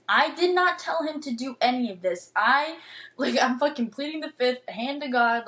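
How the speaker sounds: ordinary talk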